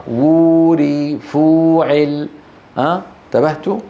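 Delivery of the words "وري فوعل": very slow وري with a long held uu: wuu-ri; long waw. Shown as a demonstration. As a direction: stretch the first vowel of وري and فوعل alike. Demonstrates long waw and pattern.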